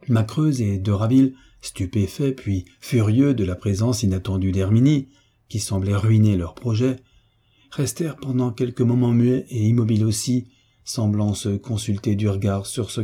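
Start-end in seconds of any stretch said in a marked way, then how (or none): none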